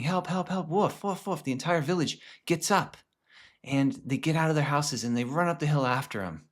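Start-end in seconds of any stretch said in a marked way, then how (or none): 0.0-1.4 mock screaming. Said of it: "as he's screaming".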